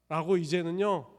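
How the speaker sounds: ordinary talk, nothing marked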